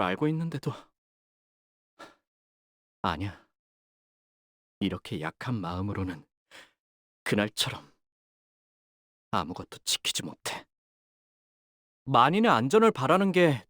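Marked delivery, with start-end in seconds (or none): 3.0-10.7 low, soft inner voice; hushed and lower, turned inward; quiet self-reproach. Acted. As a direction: murmur it to yourself in dejection. Inner doubt, talking to herself.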